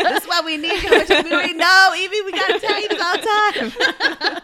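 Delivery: loud, high-pitched, through laughter; laughing teasing. Real, not acted. Teasing Evie that they need her, laughing loudly. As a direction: gush it out while cracking up.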